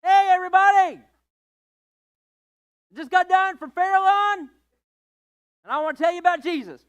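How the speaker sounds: high, loud hammed-up shout to a crowd; preaching at shoppers in Walmart; mock store announcement. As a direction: yell it across the whole store. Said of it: "walk into Walmart and go".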